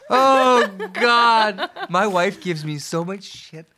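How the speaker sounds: high, loud words through laughter; laughing. Real, not acted. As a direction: still cracking up while talking. Recovering from helpless laughter.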